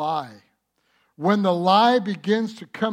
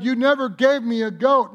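louder and higher, indignant son; loud mock complaint; 7 semitones higher, 8 dB louder than usual. Preacher voicing the older son's loud complaint. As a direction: fling the complaint out loudly.

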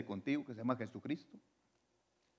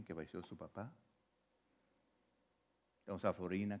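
much softer, sombre naming of the dead; hushed mourning; 6 semitones lower, 12 dB quieter, faster than usual. Real, not acted. Pastor naming the congregation's lost loved ones, hushed and sombre. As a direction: lower your voice in grief for the departed. Pastor lists members' deceased relatives.

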